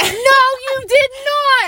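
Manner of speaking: high, slow shout of denial. Real, not acted. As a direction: yell it, no way. Denying in a heated argument.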